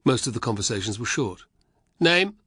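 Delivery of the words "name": higher, louder soldier demanding a name; barking guard. Acted. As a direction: bark the question like a gate guard. Soldier questioning at gate.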